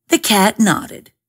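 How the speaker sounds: ordinary delivery, nothing marked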